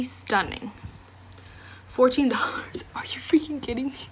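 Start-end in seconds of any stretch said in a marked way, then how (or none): 1.9-4.0 stunned whisper with a laugh; shocked whisper. Real, not acted. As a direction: whisper it in disbelief. Shocked by the low price.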